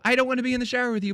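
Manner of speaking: rushed, high-pitched brush-off; mock hasty brush-off. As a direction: blurt it fast and bail out. Retold quick rejection on stream.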